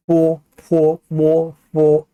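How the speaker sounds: 뽀, 포, 모, 포 each held long on a level tone; lip sounds. Shown as a demonstration. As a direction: recite 뽀, 포, 모, 포 slowly from the lips. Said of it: "입술 소리예요".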